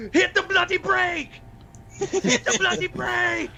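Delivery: high-pitched, hammed-up mock yell; William Regal yelling. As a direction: yell it high like a panicked driver impression, stretching the repeat. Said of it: "then he yells at Tajiri".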